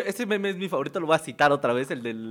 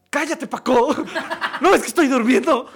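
higher, louder grumpy sister, through laughter; imitating annoyed sister; 8 semitones higher, 5 dB louder than usual. Brother imitating sister scolding him for waking her, high mock annoyance. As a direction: snap back like a sleepy sister who was woken. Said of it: "me dijo mi hermana".

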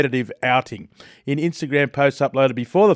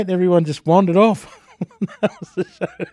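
usual speaking voice

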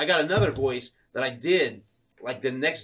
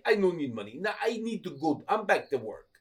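put-on Russian, brushing off; curt refusal in a mock Russian accent; 3 semitones higher, 4 dB quieter than usual. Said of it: "this Russian guy".

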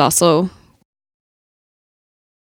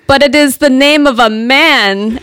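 put-on slow, higher Russian accent, amused; 6 semitones higher than usual. A Russian man teasing the woman named Alexei, in a Russian accent. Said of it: "my best Russian accent".

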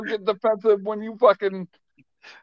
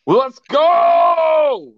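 high, loud, drawn-out hype shout; happy yell; 11 semitones higher, 6 dB louder than usual. Acted. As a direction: yell it out, overjoyed. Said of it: "I yell when I'm happy".

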